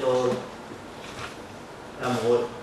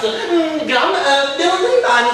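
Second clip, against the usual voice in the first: high, loud fussing mum with ehm; flustered mother; 9 semitones higher, 7 dB louder than usual. Acted. Mother voiced. (mamma agitata, acuta e forte)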